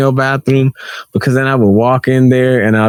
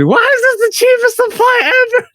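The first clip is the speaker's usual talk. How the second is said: much higher, outraged yell; outraged shout. Retold or imitated. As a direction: scream at the cheap supplies. Repeats complaint.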